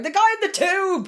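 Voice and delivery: high, loud frustrated yell; shouting at the screen. Retold or imitated. Viewer yelling at the TV characters, shouting. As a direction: yell it like they can't hear you. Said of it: "I was basically shouting at the screen".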